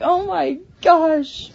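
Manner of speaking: high, slow drawn-out oh my gosh; re-enacted dramatic dismay; mock gasp. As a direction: stretch it out in mock shock. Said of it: "I was like".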